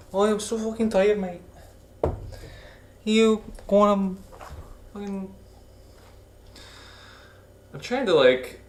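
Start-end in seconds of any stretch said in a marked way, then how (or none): 0.1-5.3 a hammed-up Irish lilt, pitched up; Irish accent. Shown as a demonstration. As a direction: belt out a funny Irish voice. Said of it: "things sounding Irish".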